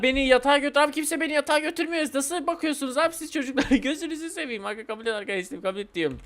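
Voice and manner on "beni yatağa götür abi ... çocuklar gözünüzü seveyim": high-pitched pleading complaint; mock whining. Player as sleepy baby begging the others, high mock whine. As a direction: whine like a baby nobody will put to bed. Baby begs to be put to bed.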